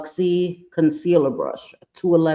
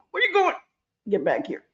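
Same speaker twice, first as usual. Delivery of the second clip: mock chase; 9 semitones higher, 4 dB quieter than usual. Chasing the runaway liner.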